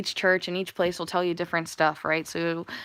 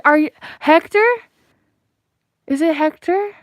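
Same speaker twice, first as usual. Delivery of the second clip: much higher and louder, gasping disbelief; excited recognition; 9 semitones higher, 8 dB louder than usual. Real, not acted. Channeler suddenly recognizing a viewer, high excited gasps. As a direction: gasp and cry out the name in startled recognition.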